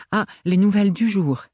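put-on a young girl's delighted exclamation; exclaiming. A narrator's reading. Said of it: "s'exclama Gaieté".

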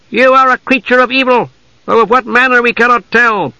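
high-pitched hostile elf voice; accusing elf. Acted. Elf warrior confronts the dark knight, high and accusing. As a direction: pronounce the accusation high and hard.

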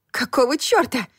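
sharp startled exclamation; exclaiming. A narrator's reading. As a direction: blurt it out in shock. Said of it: "воскликнула я".

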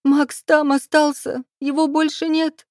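high, slow wailing through tears; wailing into hands. Acted. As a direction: sob it out, face in hands. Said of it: "закрыв лицо руками, заголосила".

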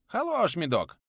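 high, delighted peasant voice; relishing. Acted. Nikodim praising the mead, high and delighted. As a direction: savour it toothlessly.

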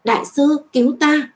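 high-pitched, urgent call; frightened cry for help. A narrator's reading. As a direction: cry out for rescue, pitch jumping up. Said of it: "nhịn không được hô lớn".

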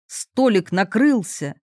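plain reading voice

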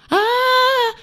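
high shrill terrified scream; piercing scream. Acted. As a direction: scream at the top of your lungs. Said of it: "завизжала, почти срывая горло". (пронзительный визг)

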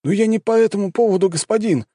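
high-pitched plea to a superior; pleading. A narrator's reading. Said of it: "взмолился боец". (умоляюще)

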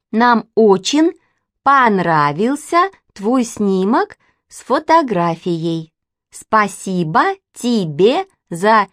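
very slow, laboured, over-enunciated syllables; child's letter reading. A narrator's reading. Katya and Manya write to the mysterious stranger, painstakingly slow. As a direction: sound it out word by word like a young child writing.

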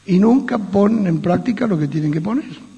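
ordinary talk, nothing marked

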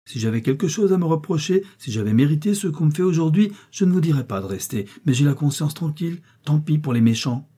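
high-pitched, impassioned self-defence; indignant. A narrator's reading. Daughter telling her mother why she must stay, indignant. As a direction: argue your innocence with rising fervour.

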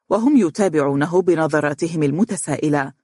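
ordinary delivery, nothing marked

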